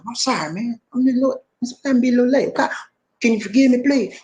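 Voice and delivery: high-pitched, pleading mock apology. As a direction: beg playfully in a high voice. Playful begging for forgiveness when late.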